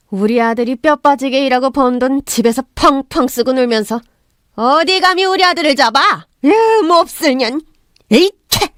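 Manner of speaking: put-on high, outraged older-woman rant; furious scolding. A narrator's reading. Mother-in-law blames son's wife for his affair, raging. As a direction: build to a disgusted explosion. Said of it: "저를 나무라셨어요".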